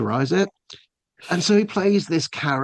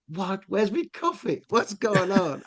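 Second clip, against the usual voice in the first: higher, bewildered questions; puzzled, as if waking up lost; confused; 7 semitones higher than usual. Retold or imitated. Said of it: "I was most confused".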